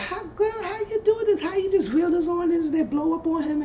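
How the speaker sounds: very high falsetto, gossipy amazement; squeaky falsetto girlfriend. Retold or imitated. Mimicking castmate's amazed gossip.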